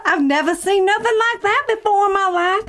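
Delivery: high-pitched hammed-up Southern belle; Southern belle accent. Improvising an abduction witness with a co-host, Southern accent. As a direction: play an astonished Southern witness. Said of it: "our Southern Belle accents".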